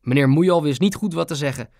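ordinary delivery

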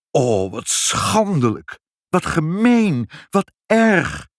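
high, drawn-out outrage; indignant cry. A narrator's reading. The family hears of the eviction, crying out indignantly. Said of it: "riepen vader, moeder en Ibbeltje".

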